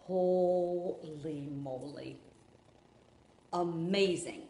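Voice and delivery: very slow, drawn-out amazement; long, savoured exclamation of awe; awestruck. Real, not acted. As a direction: stretch out each word in wonder. Marvelling at the lace detail.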